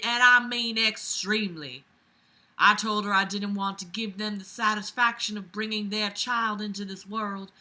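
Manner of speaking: put-on Southern belle accent; [name], Southern belle. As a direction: read emphatically as a Southern belle. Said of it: "my Southern Belle accent".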